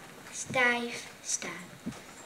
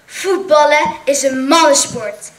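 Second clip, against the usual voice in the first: shouted; 4 semitones higher, 12 dB louder than usual. A narrator's reading. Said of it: "schreeuwde Franklin".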